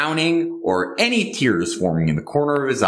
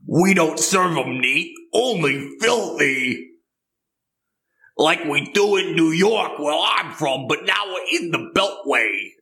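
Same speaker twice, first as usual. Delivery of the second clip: put-on a light Brooklyn accent, pitched higher; 5 semitones higher than usual. Bartender to customer, a hint of Brooklyn accent. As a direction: bartender sounding off in a mild New York accent. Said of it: "What accent is that? that's New York. That's a Brooklyn accent".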